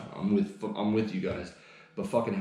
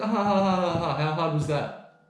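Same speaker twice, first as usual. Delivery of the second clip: high, louder taunting ha ha; gloating mock laugh; 7 semitones higher, 4 dB louder than usual. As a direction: rub the victory in, slowly.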